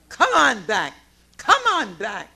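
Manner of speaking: loud, urgent call to come back. Real, not acted. Speaker urging people in the meeting to come back, loudly. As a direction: call them home, loud.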